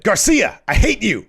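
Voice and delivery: high, loud mock snarl of hate; Eddie Kingston impression. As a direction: yell it through bared teeth like a wrestling promo. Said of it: "when he said".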